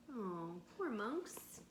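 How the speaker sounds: ordinary delivery, nothing marked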